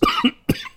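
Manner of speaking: rough cough mid-sentence; harsh cough from the chest; chesty cough. Real, not acted. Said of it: "oh man".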